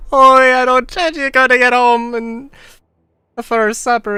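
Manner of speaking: excited outburst. Real, not acted. Excited loud outburst to friend.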